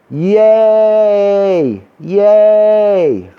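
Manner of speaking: long, drawn-out mock yays; sarcastic cheering. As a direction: cheer with obviously fake joy.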